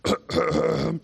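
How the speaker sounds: acted hacking cough; sore-throat cough. Miming illness for the children's talk, pretend sore-throat cough. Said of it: "you had a sore throat".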